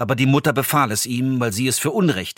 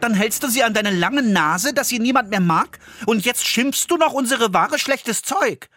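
put-on higher boy's voice, fast and annoyed at first; indignant boy; 11 semitones higher than usual. Jakob telling off the old woman for squeezing the herbs. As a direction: scold her like a cheeky cross lad. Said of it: "rief er unmutig".

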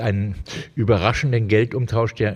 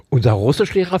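a touch of shocked alarm, pitched up; horrified question; 5 semitones higher, 5 dB louder, faster than usual. A narrator's reading. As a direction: gasp it out in dismay. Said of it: "fragte sie entsetzt".

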